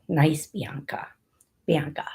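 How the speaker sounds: low whispered "Nice Bianca"; sweet whisper. Pretend. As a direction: coo the name gently in a whisper. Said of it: "a naughty, nice name".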